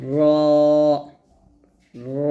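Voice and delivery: র said full and heavy, tongue tip curled to palate; thick, heavy র; র drawn out with a full, heavy sound; heavy r demo. Shown as a demonstration. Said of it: "তো এটা হবে".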